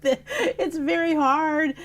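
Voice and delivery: high, laughing reply, slow at the end; laughing protest. Retold or imitated. Retorting to mother, laughing.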